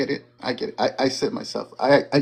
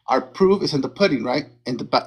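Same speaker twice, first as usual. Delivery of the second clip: normal talking voice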